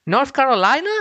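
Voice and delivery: a sudden high incredulous question; disbelieving. Real, not acted. Host hearing the team's home state, incredulous. Learning Haas is in North Carolina.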